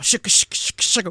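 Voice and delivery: whispered shaka shaka rhythm; shaka beat imitation. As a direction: tap the rhythm out in breathy syllables. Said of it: "it's just going".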